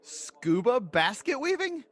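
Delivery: ordinary talk, nothing marked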